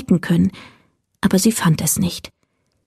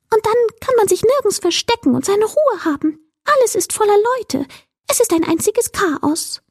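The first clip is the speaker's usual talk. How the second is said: high, agitated little creature voice; fretful will-o'-the-wisp; 12 semitones higher than usual. A narrator's reading. Creature grumbling to Mila about daytime, high and fretful. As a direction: complain in a much higher voice.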